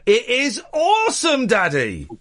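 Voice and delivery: imitating his boy, high and drawn out; high-pitched, slow gush of a delighted kid; excited young son. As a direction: play the thrilled child. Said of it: "my eldest loves it".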